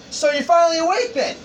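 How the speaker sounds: high, loud, quick character voice; Dawes. Acted. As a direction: pitch it up and fire it off fast. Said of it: "Dawes looking at him going".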